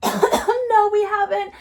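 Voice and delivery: high, loud mock answer; mimicked passers-by. Voicing passers-by answering her.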